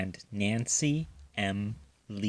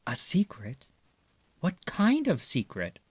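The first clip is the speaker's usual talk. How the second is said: put-on curious child raccoon; 7 semitones higher than usual. A narrator's reading. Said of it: "looked interested".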